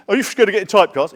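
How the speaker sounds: imitating a taxi driver's question; mock taxi driver. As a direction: fire it off like a cabbie prying. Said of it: "taxi drivers used to say that to me".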